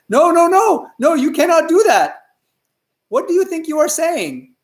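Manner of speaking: high-pitched, emphatic refusal; scandalized teacher putting a stop to it; aghast objection. Acted. Teacher aghast, forbids the offer.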